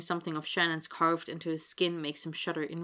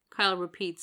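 ordinary delivery, nothing marked

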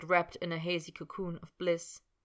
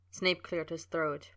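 ordinary delivery

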